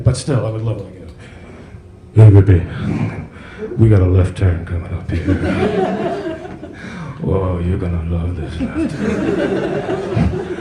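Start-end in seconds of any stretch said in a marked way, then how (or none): 2.1-10.5 low, slow, seductive crooning GPS voice. Pretend. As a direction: purr it like a smooth soul singer.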